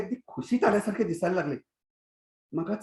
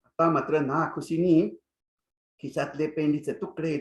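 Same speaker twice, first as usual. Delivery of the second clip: ordinary delivery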